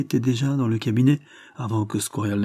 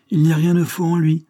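with emotion; 7 semitones higher, 6 dB louder than usual. A narrator's reading. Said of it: "continua Lord Dane avec émotion".

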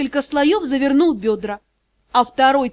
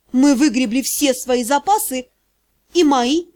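ordinary delivery, nothing marked

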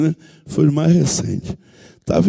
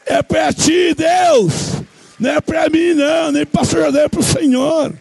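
preaching shout; 9 semitones higher than usual. Real, not acted. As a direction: cry it out, giving God the glory. Preacher giving glory, shouting.

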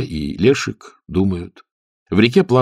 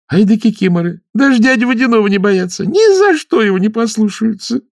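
high, loud, teasing; teasing mermaid; 14 semitones higher, 4 dB louder than usual. Acted. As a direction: needle him playfully. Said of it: "засмеялась". (задорная русалка)

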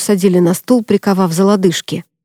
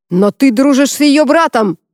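barked accusation, higher; sharp shout; 5 semitones higher than usual. Acted. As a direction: snap at the prisoner. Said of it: "прикрикнул высший жнец". (резкий окрик)

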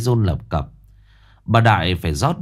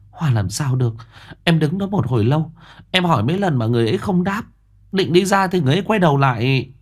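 much higher, fast, urgent telling; insistent frightened woman; 7 semitones higher, faster than usual. Acted. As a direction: rush through it, still scared. Frightened sister-in-law insists.

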